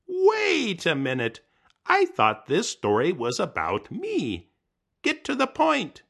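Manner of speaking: high, loud protesting voice; high squeaky heckler cutting in; indignant interrupting character. Acted. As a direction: butt in, offended the story strayed.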